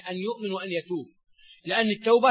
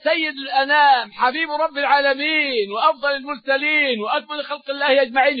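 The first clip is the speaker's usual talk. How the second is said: raised, slow solemn preaching; preaching praise; 7 semitones higher, 5 dB louder than usual. Acted. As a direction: lift the voice in reverent praise.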